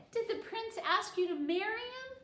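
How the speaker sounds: put-on a child piping up; eager child's question. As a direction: ask it wide-eyed like a curious child. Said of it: "Kiko asked".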